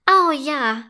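eager bright agreement; excited. A narrator's reading. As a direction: cheer it gladly. Unicorns agree in chorus.

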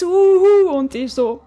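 ordinary talk, nothing marked

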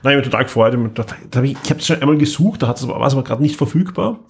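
ordinary talk, nothing marked

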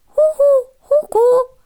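sehr hohes, piepsiges Kükenstimmchen; peeping baby chick. Acted. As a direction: quietschend hoch und freudig rufen. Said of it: "piepste sie". (piepsendes Küken)

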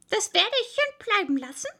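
high, fast cross refusal; quick, high-pitched irritated retort; annoyed mouse. A narrator's reading. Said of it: "sehr ärgerlich".

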